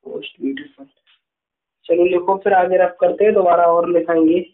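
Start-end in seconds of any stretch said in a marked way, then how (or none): 0.0-0.9 soft whisper. Shown as a demonstration. Teacher dictating answer softly.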